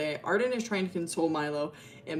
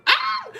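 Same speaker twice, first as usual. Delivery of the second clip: short, loud, very high squeal; sudden high shriek; 8 dB louder than usual. Real, not acted. Teasing guys about the lore, sudden squealed scream. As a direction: let out a quick piercing yelp.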